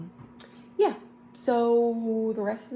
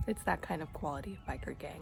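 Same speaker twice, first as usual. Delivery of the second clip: whispering; 2 semitones lower, 7 dB quieter, faster than usual. Real, not acted. Whispered aside near baby.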